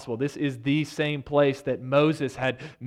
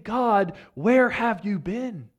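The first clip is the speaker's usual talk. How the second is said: high, louder pleading questions to God; raised exasperated pleading; 5 semitones higher, 5 dB louder than usual. Retold or imitated. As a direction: press God for answers, voice raised. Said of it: "he's basically saying".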